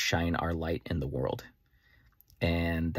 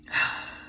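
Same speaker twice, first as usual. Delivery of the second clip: long airy sigh; contented sigh. Real, not acted. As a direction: let out a full, unvoiced breath of a sigh. Moved by plan coming to life.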